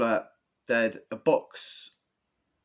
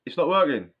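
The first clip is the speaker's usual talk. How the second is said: raised, echoey shout of the lads; louder retold call; 5 semitones higher, 7 dB louder than usual. Retelling cellmates calling out that it's broken, louder. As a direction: call it out louder, as heard stoned. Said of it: "they're like".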